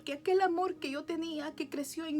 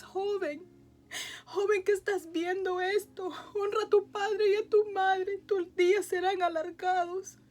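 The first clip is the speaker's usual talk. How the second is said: higher and louder, voice breaking with tears; tearful preaching; 4 semitones higher, 5 dB louder than usual. Real, not acted. Urging young viewers to honor their parents, crying and loud.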